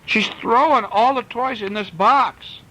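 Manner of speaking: put-on high, slow distressed child; storyteller voicing a tearful kid; upset child. A narrator's reading. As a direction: whine it like a child losing toys. Kids wailing about toys.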